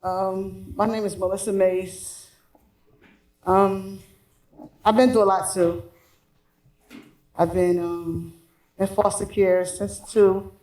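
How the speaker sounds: muffled behind a mask; speaking through a covering mask; through face mask. Real, not acted. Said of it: "I'm sorry I have a mask on".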